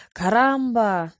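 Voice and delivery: loud, slow outcry of pain; exclaiming. A narrator's reading. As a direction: cry it out, drawn out. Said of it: "воскликнул раненый".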